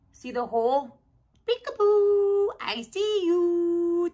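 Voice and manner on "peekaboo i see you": playful peekaboo. Acted.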